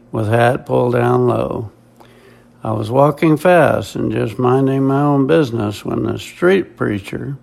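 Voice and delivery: ordinary delivery, nothing marked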